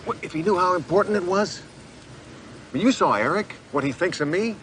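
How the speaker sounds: high-pitched, urgent plea; pleading. Acted. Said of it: "I'm begging you".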